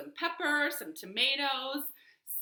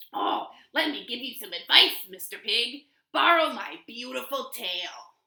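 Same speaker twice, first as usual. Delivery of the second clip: louder, sly fox voice offering a favour; 7 dB louder than usual. Acted. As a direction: talk the pig into it, smooth and loud. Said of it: "he said".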